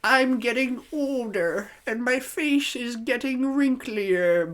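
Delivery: high, slow put-on whine about aging; mock old-age lament; worried aging complainer. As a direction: draw it out high like someone fretting over age. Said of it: "they're like".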